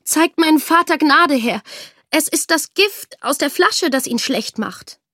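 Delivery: hohe, lautere, bittende Stimme; für den Vater um Gnade bitten; flehend. Acted. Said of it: "bat die Tochter". (Tochter bittet den König um Gnade, flehend)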